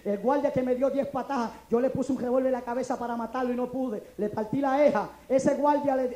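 ordinary talk, nothing marked

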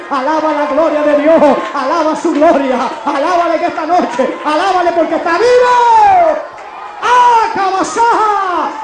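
loud, rising preacher's cry; full-force preaching. Acted. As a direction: drive it home to the congregation at full volume.

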